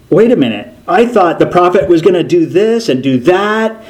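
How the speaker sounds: indignant complaint. Retold or imitated. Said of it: "So he's like".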